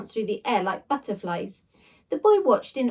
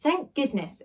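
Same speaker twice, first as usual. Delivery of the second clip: ordinary delivery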